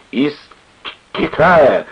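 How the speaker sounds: put-on drunken stutter on the first sound; stammering. A narrator's reading. Drunk sailor answering friends, stammering. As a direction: stumble on the word, then blurt it slowly. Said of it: "заикаясь, ответил Тихоня".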